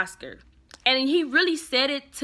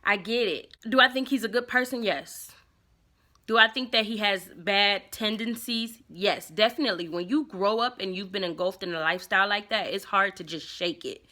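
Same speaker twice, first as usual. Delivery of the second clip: usual speaking voice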